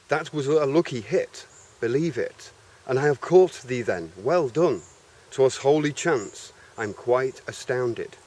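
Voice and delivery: theatrical verse reading, devil and Faust in turn; lightly acted dialogue between Mephistopheles and Faust; dramatic reading. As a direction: declaim the old English verse like a stage actor. Reads the English translation theatrically.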